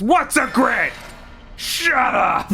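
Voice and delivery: high, contemptuous mock outburst; imitated angry snap. Streamer imitating a voice actor's contemptuous shut up. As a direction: spit out shut up with scorn, imitating him. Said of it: "sounds so angry".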